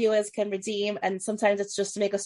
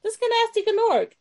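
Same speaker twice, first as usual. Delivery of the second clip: put-on Southern Belle accent. Host showing off her Jezebel character, Southern Belle accent. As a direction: play a chatty Southern Belle. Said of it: "so is Southern Belle".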